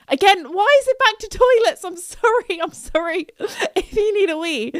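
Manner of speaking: high, laughing apology through giggles; can't stop giggling while saying sorry; giggly. Real, not acted. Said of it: "I got the giggles".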